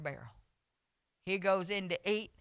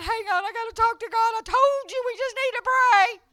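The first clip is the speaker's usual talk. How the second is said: loud high wail; crying; 11 dB louder than usual. Retold or imitated. Insisting on prayer while in horrible pain, crying. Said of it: "I'm crying 'cause it's a horrible".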